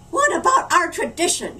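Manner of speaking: put-on old woman snapping a reproach; snapped. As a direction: snap at the mayor. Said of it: "she snapped".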